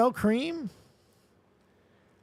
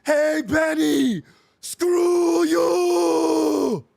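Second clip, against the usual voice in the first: loud gravelly Austrian bellow, climbing high; Arnold Schwarzenegger; 7 semitones higher, 5 dB louder, much slower than usual. Acted. Host does his favorite impression, gravelly Austrian movie shout. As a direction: yell the catchphrases in a thick Austrian accent, stretching the last. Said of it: "It's very, uh, gravelly".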